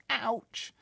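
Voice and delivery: high, loud startled cry; startled ouch. Acted. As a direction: jump at the danger. Sophie's startled thought.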